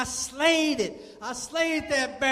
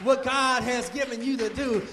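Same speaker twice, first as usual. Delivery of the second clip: ordinary talk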